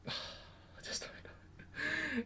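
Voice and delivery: breathy sigh into a whisper. Retold or imitated. Fan recalling how hopeless the scene felt, whispered. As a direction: trail off, lost for words. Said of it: "I just like".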